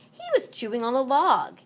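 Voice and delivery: ordinary delivery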